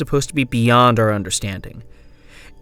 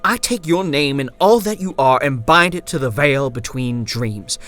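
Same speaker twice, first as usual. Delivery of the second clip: higher, forceful ritual incantation; proclaiming; 5 semitones higher, 4 dB louder than usual. A narrator's reading. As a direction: pronounce a curse with mounting force. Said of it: "he proclaimed".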